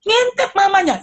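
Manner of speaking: put-on high pleading kid voice; a little one begging to peek at mom; child bargaining. (anak merengek dengan suara tinggi)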